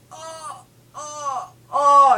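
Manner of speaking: voicing a hurting wrist with whimpers that burst into a loud cry; mock whimpering that ends in a yelp; playful pained oh oh OH. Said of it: "my hand was like".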